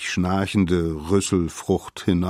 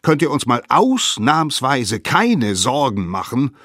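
loud, exasperated higher voice; scolding; 6 semitones higher, 6 dB louder than usual. A narrator's reading. Elders reproach the late-returning children, scolding. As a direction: hammer out the reproach. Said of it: "schimpften sie".

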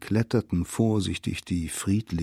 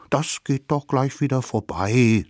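higher little-figure voice; placating little savages; 5 semitones higher than usual. A narrator's reading. Savages reassure the sore mammoth, placating. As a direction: brush the pain away sweetly.

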